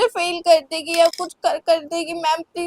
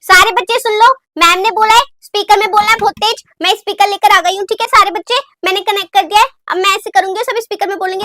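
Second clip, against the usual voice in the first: loud, high announcement to the class; rally everyone, call it out high and loud; 6 semitones higher, 7 dB louder, faster than usual. Acted. Student shouting instructions to classmates, loud and high.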